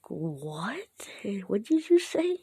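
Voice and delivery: disbelieving double take that leaps up in pitch; acted shock. Character reacting to the sticky balls remark, acted shock.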